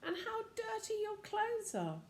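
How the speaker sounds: mother scolding. A narrator's reading. Mummy scolding the children.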